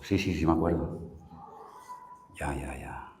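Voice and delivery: very low, soft murmur, fading away; reluctant admission. Retold or imitated. Believer admitting to God he remembers, reluctantly. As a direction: own up almost under the breath.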